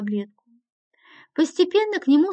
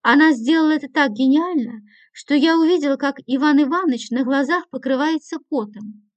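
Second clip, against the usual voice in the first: ordinary delivery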